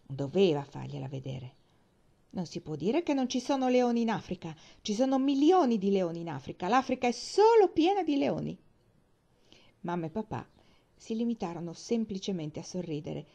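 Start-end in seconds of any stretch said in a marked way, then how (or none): 2.3-8.6 put-on high, loud childish arguing; indignant insistence. A narrator's reading. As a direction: insist louder and higher, like a child contradicted. Child argues back after being contradicted.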